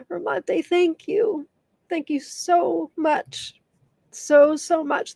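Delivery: tearful, high and slow thanks; barely holding back tears; choked-up thanks. Real, not acted. Emotional thanks after tribute.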